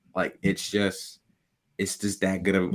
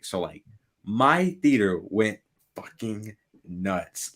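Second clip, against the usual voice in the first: ordinary talk, nothing marked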